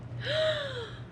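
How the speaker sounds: high, soft drawn-in gasp; shocked gasp. Acted. Reader alarmed for the broom riders, shocked gasp.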